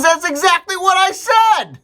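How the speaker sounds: high, loud, drawn-out indignation; exasperated outburst. Acted. Little Johnny protesting to his dad, exasperated shout. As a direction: burst out in mock exasperation, high and slow.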